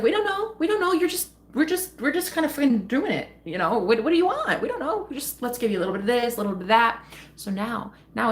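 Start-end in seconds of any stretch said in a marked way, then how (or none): 0.0-7.0 high, giddy, half-laughing clueless voice; hammed-up clueless universe, laughing through it. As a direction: play the universe shrugging, totally lost. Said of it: "it's like".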